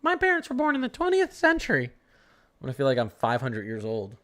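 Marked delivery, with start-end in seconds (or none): 0.0-1.8 high mock-kid whine about old parents. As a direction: mimic a bratty kid teasing old parents. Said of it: "mimics future kids".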